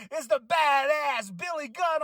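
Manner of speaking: lightly voiced wrestler hyping Billy Gunn; dramatic reveal. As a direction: drag out the name like a showman.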